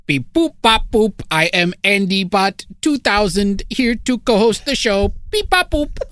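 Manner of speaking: high, slow robotic beeping voice. Pretend. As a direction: play a cheesy robot. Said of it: "your robot gaze".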